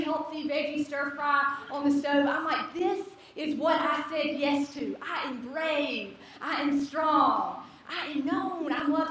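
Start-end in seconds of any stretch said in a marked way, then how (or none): 2.7-9.1 slow, drawn-out declarations; retold pep talk, savoring every word; self-affirmation. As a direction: stretch out each affirmation with conviction.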